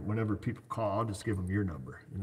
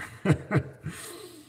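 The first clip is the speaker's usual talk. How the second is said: airy high-pitched chuckle; high breathy laugh; 12 semitones higher than usual. Real, not acted. Mechanic laughing at his own blunt refusal, airy laugh. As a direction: let a light laugh slip out. Laughs after declining YouTubers.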